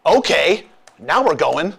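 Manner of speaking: a punched-up, loud quote of your own reaction; emphatic retold reaction. Customer retelling his reply to the bartender, loudly emphatic. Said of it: "I'm like".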